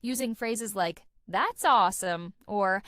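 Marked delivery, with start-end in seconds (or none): 1.3-2.8 punchy, louder exclamation of praise; enthusiastic. Shown as a demonstration. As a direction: react with loud enthusiasm. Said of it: "adds enthusiasm and energy to conversations".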